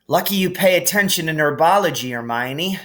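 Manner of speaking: lightly voiced relief; a hint of relieved boy; relieved Harry. A narrator's reading.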